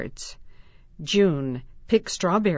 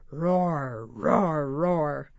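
lion roar; 3 semitones lower, much slower than usual. Pretend. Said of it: "Roar like a lion".

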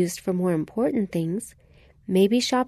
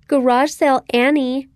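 loud, higher-pitched name-calling taunt; mocking; 6 semitones higher, 6 dB louder than usual. Jenny taunting Annie in the cafeteria, loud mocking. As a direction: jeer it like a schoolyard nickname. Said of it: "she mocked".

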